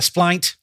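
splint said as "splynt"; long i in splint; rhymed with pint. Shown as a demonstration. Host testing the new rhyme in a sentence, splint rhyming with pint. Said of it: "make them rhyme with pint".

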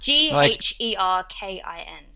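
slow, letter-by-letter spelling. Shown as a demonstration. British guest spells gherkin for the American host, spelled slowly. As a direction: spell it out one letter at a time.

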